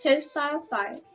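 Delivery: usual speaking voice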